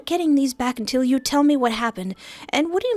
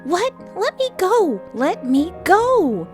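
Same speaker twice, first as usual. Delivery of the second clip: high-pitched, struggling cries; outraged protest; 12 semitones higher, 5 dB louder, slower than usual. Acted. Fia is caged in Lial's arms, high outraged protest. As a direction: demand release, high and stretched out.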